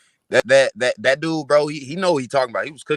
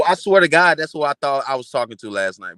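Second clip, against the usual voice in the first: ordinary talk, nothing marked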